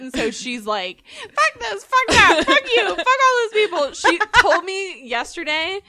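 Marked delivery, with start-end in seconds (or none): 1.2-3.9 high, loud retold rant; mock swearing spree. Mom who now swears everywhere, mock loud rant. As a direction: fling out each curse high and loud.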